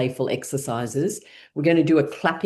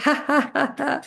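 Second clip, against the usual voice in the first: forced laughter; 12 semitones higher, slower than usual. Shown as a demonstration. Teacher leads class in laughter exercise, high laughing. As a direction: laugh it out on purpose, pitched up high. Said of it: "we're gonna do it with laughing".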